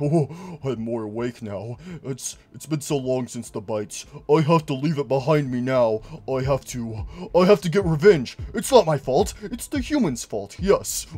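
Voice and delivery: building villain voice; vengeful Golden Freddy. Retold or imitated. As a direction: plot revenge darkly. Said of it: "he's like".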